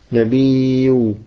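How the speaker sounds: نبي drawn out slowly as nabiiiw-u; original waw ending. Shown as a demonstration. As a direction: stretch نبي and finish on the waw. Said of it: "والواو هي اللام".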